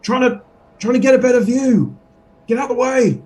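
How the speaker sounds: a high squeaky voice brushing past the guard; high put-on character voice. Player character pushing past the bugbear, high put-on voice.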